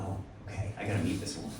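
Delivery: low, soft aside to himself; hushed inner thought. Retold or imitated. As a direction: think it quietly, very softly. Private thought on seeing her.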